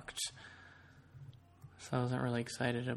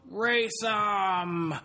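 much higher, louder shouted challenge; drunk shout; 7 semitones higher, 4 dB louder than usual. Retold or imitated. Imitating drunk friend shouting from the back seat, loud and high. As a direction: bark it like a rowdy drunk. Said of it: "he just pipes up from the back and goes".

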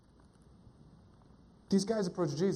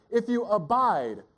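"abide" drawn out and leaned on; slow down and land on "abide"; stretched, weighted "abide"; stress on abide; slower than usual. Shown as a demonstration. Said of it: "when you emphasize a word".

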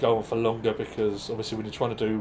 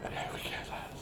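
unvoiced whisper; 13 dB quieter than usual. Real, not acted. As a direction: whisper it aside. Aside whisper.

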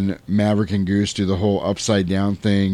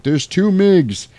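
excited callout; 10 semitones higher, 3 dB louder, slower than usual. Retold or imitated. Pilots spotting second plane.

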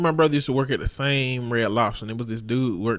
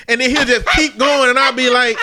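ordinary talk, nothing marked

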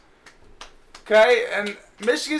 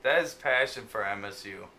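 mock announcer; 11 semitones lower, 7 dB quieter than usual. Host mock-announces co-host's passion.

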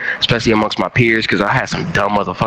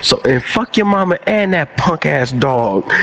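ordinary delivery

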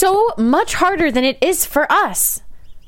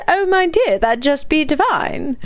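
put-on British accent; 2 semitones higher than usual. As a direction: repeat yourself in mock-British for the hard of hearing. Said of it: "how to say in a British accent".

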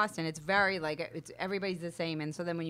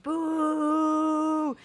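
mock crowd boo; 7 semitones higher than usual. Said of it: "whole eleven hundred people went".

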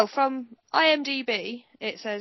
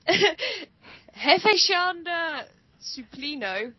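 slow, laughing stab at the name; laughing through names; 7 semitones higher, much slower than usual. Real, not acted. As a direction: giggle while sounding it out. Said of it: "totally not gonna be able to pronounce".

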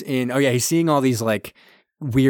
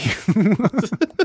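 laughing; 9 semitones higher than usual. Real, not acted. Laughing at the absurd scene.

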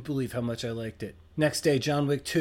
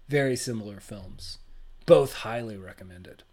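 ordinary talk, nothing marked

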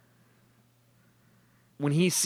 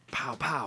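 imitated gunshots; 6 semitones higher, 7 dB quieter than usual.

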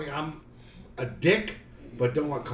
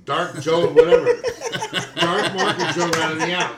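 laughing accusation; 5 dB louder than usual. Real, not acted. Laughing accusation among friends.

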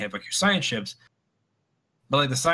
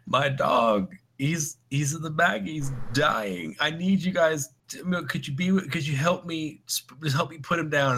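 a touch of a tearful plea, pitched a bit higher; mock crying caller; 6 semitones higher than usual. Retelling a caller begging the cop to put his dog down, mildly tearful. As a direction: play a weepy owner asking for help, lightly. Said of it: "he was crying to the".

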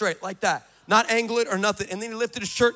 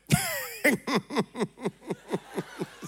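hearty imitated laugh; God laughing; 5 semitones higher, 3 dB quieter than usual. Preacher voicing God laughing at him. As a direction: laugh like God finds it funny. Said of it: "And he went".

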